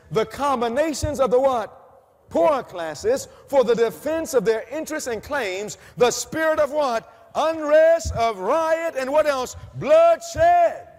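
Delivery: loud, high, building to a shouted bloodshed; preaching shout. Acted. Preacher shouting the prophet's warning to the congregation.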